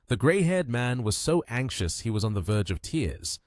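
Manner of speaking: ordinary delivery, nothing marked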